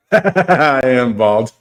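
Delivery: bursting into laughter at the insult; laughing. Real, not acted. Realises chat called him bald.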